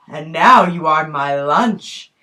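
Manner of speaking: low, sly predator's line; low snake voice. Acted. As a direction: savour it like a hungry snake. Snake announces its meal.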